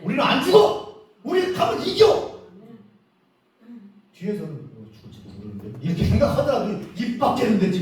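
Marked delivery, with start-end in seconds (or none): 0.0-2.3 bold shout. Acted. Bold declaration of faith. 4.5-5.8 much lower and far softer; timid mutter. Acted. Doubtful inner voice behind.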